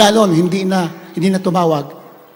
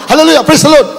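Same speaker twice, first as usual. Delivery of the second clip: higher, louder shout of praise; shouting prayer; 6 semitones higher, 6 dB louder, faster than usual. Retold or imitated. Blindfolded captive shouts praise to God, loud shout. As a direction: cry out for rescue. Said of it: "Sigaw siya na sigaw".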